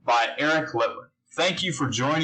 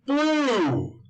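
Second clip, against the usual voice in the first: excited; 10 semitones higher than usual. Acted. Kids answer called out.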